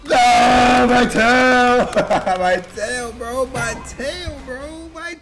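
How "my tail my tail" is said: ordinary talk, nothing marked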